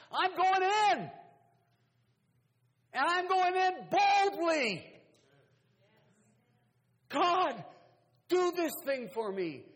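very high, very loud, drawn-out cry; triumphant pulpit shout; full-force preaching shout. Acted. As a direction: roar it boldly to heaven. Sermon climax, bold cry to God.

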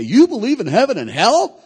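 mock disbelief, high and loud; incredulous question. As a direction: challenge it as a scandalized skeptic, voice swooping high.

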